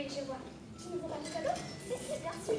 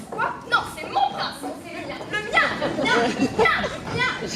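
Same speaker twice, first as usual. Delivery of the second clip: louder and louder mine, mine, mine; possessive squabble; 5 semitones higher, 13 dB louder than usual. Acted. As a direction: claim him like a child grabbing a toy. Two women fight over prince.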